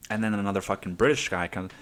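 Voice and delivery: usual speaking voice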